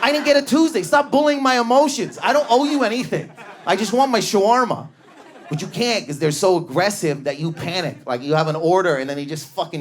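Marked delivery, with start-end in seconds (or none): 0.0-4.9 flustered customer. Retold or imitated. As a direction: babble back in high-pitched panic. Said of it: "You're like".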